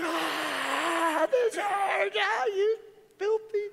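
very high wailing cry of pain; hammed-up shriek of a patient in agony; mock crying scream. Knee patient crying out and cussing at the therapist. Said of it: "She's like".

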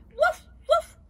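high, loud imitated woofs; two sharp dog barks; dog's bark. Reader answers as the uncle's dog, high woofs. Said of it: "a su perro".

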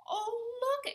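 high, loud delighted exclamation; excited woman's high call; exclaiming. A narrator's reading. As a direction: cry out at a sudden sighting. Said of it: "exclaimed the younger woman".

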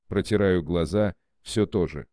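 normal talking voice

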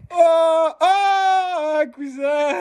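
loud high excited call to a friend. Real, not acted. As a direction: shout a happy greeting across the house. Calling out to cousin.

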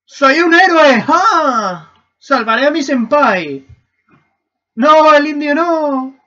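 high, loud, drawn-out battle cry; hammed-up heroics, slow and stretched; mock heroic shout. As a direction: holler like an over-the-top rescuer. Playing hero charging to rescue.

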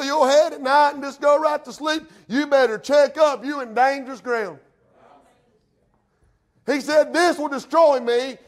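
loud, high pulpit warning; full-force preaching. Real, not acted. Preacher warning those comfortable in sin, full-force preaching. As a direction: warn them hard from the pulpit.

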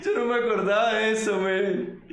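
laughing hard while saying it, pitch up; words bursting out through real laughter. Real, not acted. Creator watching his old clip, laughing hard.

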